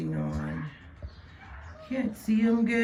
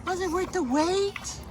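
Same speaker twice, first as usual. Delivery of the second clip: much higher, sing-song question; high pet voice; 10 semitones higher than usual. Real, not acted.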